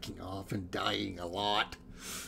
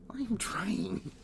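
whispering; 8 semitones higher, 7 dB quieter than usual. Real, not acted. Whispered strain.

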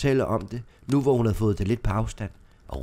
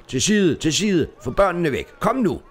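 shouting orders; 8 semitones higher, 6 dB louder than usual. Acted. Policemen clear the street of onlookers, shouting. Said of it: "posekikkernes råb".

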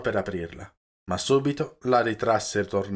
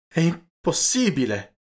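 disbelieving cry, higher and louder, slowed; shocked exclamation; 7 semitones higher, 4 dB louder than usual. A narrator's reading. Gibetti hearing his girl is married, shocked cry. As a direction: blurt it out in disbelief, drawn out. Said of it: "esclamò il Gibetti".

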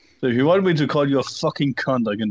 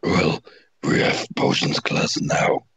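squeaky whisper; slower than usual. Acted. Willow quote, odd voice.

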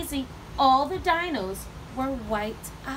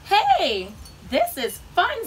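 high, louder delighted realisation; happy discovery; 7 semitones higher, 5 dB louder, slower than usual. A narrator's reading. Bird forgetting about being grumpy, delighted. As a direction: exclaim with rising delight.

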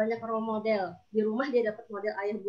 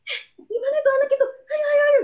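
high, loud, hurried alarm; panicked calling; 9 dB louder than usual. Acted. Adult seeing a child climbing, panicked calling. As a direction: urge in a rising panic, much higher than usual.

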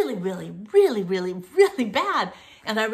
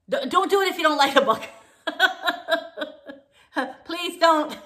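laughing through the words, fast and louder; a giggly, half-joking warning; rushed plea with a laugh in it; 8 semitones higher, 4 dB louder than usual. Real, not acted.

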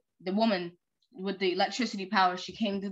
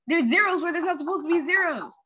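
higher, louder rising alarm; 8 semitones higher, 5 dB louder than usual. Acted. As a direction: realize the villain's sign with dread. Reading the mayor's alarm.

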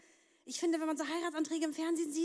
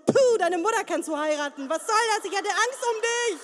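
loud, higher furious scolding; mock outrage; 5 semitones higher, 8 dB louder than usual. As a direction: tear into him for the scare. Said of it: "Ich würde da am Rand stehen und sagen".

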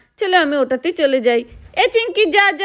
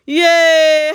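loud squeal; 5 dB louder, much slower than usual. Acted. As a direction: burst out with a loud happy yay. Gleeful jump into pool.

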